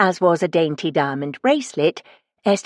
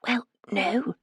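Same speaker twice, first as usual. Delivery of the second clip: whispered; 4 semitones higher, 7 dB quieter than usual. Acted. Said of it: "she whispered".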